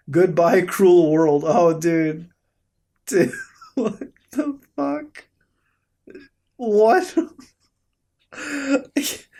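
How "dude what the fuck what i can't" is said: laughing. Real, not acted. Laughing at fight photo.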